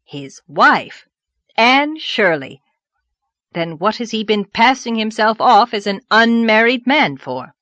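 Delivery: loud, incredulous exclamations; stunned indignation. Acted. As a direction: demand an explanation in shock.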